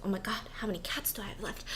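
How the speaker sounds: fast, breathless whisper; panicked whisper. Retold or imitated. Tripping guy counting cats, whispering in fast panic. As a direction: hiss it out in a rush, losing count.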